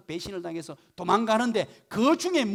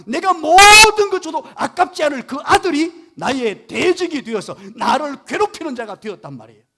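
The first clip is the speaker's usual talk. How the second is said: fervent preaching; 5 semitones higher, 15 dB louder than usual. Real, not acted. Preacher stresses betrayal by son.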